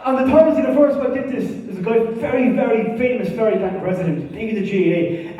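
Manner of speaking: ordinary talk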